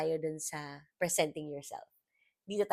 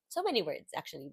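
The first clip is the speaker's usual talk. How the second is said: normal talking voice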